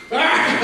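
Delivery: high mock battle yell; short hammed-up villain scream; Ganon's cry. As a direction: let out a mock roar, pitched high. Acting Ganon in retold game scene.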